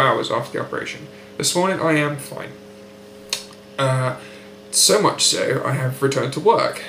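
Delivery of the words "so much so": usual speaking voice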